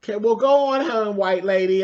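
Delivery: high, loud mocking send-off; mocking dismissal. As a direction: shoo her off loudly, from up high. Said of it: "they said".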